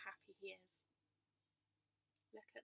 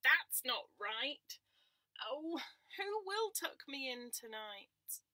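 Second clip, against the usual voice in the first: higher, slow and louder complaint; whiny lamb; 4 semitones higher, 8 dB louder, much slower than usual. Acted. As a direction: drag it out like an upset lamb.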